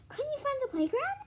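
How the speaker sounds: high classmate's question; squeaky kid asking. Acted. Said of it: "asked Calvin".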